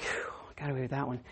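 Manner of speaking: whispered sigh of relief; relieved whisper. Retold or imitated. Boy alone after burying the duck, whispering in relief. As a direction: breathe it out like you just got away with it.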